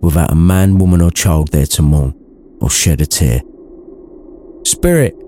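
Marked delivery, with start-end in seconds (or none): 4.6-5.1 high-voiced old Scrooge calling out; Scrooge crying out. A narrator's reading. Scrooge cries out to the spirit, high and scared. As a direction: cry out as a frightened old miser. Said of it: "cried Scrooge".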